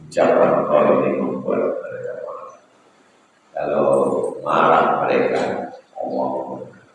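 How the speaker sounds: low, soft, quick admonition; the Prophet's gentle warning. Retold or imitated. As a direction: warn him quietly and gravely. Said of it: "Kata Nabi sallallahu alaihi wasallam".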